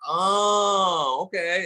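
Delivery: long, high drawn-out "oh"; dawning realization. Retold or imitated. Crowd finally getting the musical bit, high drawn-out realization. Audience finally understands the act.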